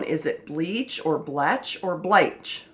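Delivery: "bleach", "bletch" slow; trying vowel sounds. Shown as a demonstration. Teacher sounds out "bleach". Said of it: "The next one".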